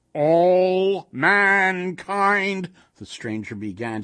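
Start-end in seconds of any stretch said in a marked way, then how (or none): 0.1-2.7 booming, very slow golem; grand monster proclamation; harsh and monotonous. A narrator's reading. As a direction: drag out each word loudly, pitch raised. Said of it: "His voice was harsh and monotonous".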